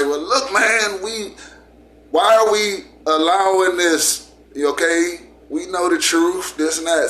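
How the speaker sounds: high, pleading, upset tiger; upset tiger arguing with the lion, pitch rising high. A narrator's reading. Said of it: "the tiger's upset".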